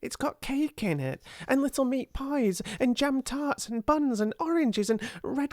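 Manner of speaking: high, excited list running on; tumbling excitement. A narrator's reading. Ermengarde listing the treats in her box, tumbling excitement. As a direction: let the words tumble over each other. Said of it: "Her words began to tumble over each other".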